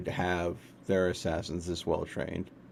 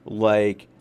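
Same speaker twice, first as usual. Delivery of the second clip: usual speaking voice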